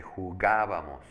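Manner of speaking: "jugábamos" slowed, with GA stretched and loud; stress on GA. Shown as a demonstration. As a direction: lean hard on the "gá" in "jugábamos". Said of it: "En ga, en esa vocal".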